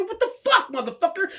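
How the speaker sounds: high-pitched, indignant challenge; outraged retort. Retold or imitated. Driver challenging the man who swerved, outraged yell. Acted road-rage quarrel.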